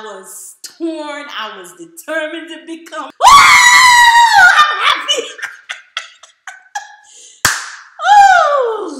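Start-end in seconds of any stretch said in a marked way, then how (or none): 3.1-5.3 very high, loud cry of happiness; joyful shout. Retold or imitated. Celebration shout. 5.3-8.1 high airy laugh of relief; breathy joyful laugh. Retold or imitated. Laughing in joy.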